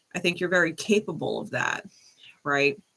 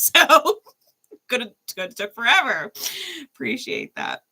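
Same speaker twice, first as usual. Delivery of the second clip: ordinary delivery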